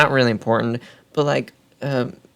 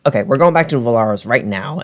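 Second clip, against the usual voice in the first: pissy; 4 semitones higher, 5 dB louder than usual. Retold or imitated. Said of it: "pissily like".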